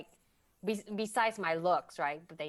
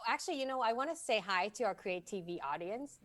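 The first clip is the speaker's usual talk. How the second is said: ordinary delivery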